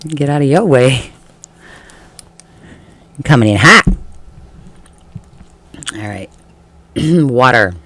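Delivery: ordinary talk, nothing marked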